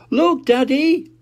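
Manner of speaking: put-on very high, slow excited child call; Peppa calling. Peppa showing Daddy the photo, very high and eager. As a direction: show daddy proudly. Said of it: "said Peppa".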